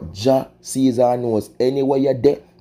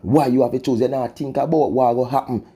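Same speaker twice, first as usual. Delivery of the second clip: normal talking voice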